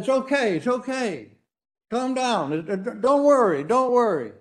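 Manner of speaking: high, earnest mock reassurance; soothing father. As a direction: hush a frightened child quickly. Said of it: "he said".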